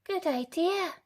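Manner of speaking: put-on high eager girl's voice; sweet and trusting; high cheerful agreement; little girl. A narrator's reading. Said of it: "said Little Red".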